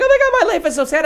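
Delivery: high, fast, half-laughing mock sob; mock crying. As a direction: ham up a weepy wail while giggling. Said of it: "crying, like".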